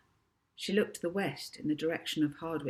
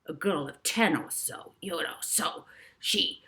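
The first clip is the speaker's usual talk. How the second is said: insistent, trailing off; lightly voiced villain; pushy professor; 4 dB louder than usual. A narrator's reading. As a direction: press the point, then fade. Professor pressing the clerk.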